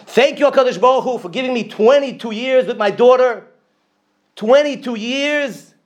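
high, loud, fervent thanks to God. Retold or imitated. Grieving father thanking God at the eulogy, high fervent cry. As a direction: lift the voice high in grateful praise. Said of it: "He started off the thing and he said".

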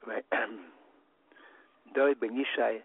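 normal talking voice

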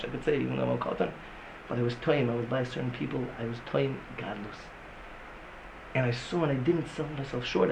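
low, soft, intimate lecturing; subdued voice, dropping near a murmur; hushed confessional. Real, not acted. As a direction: confide it quietly to the room. Hushed personal confession to the room.

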